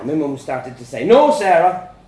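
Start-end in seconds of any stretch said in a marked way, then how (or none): 1.0-1.9 loud, firm dad; cutting her off. A narrator's reading. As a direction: overrule her loudly. Dad cuts mum off.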